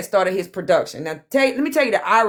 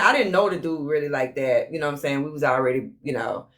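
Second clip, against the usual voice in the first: ordinary talk, nothing marked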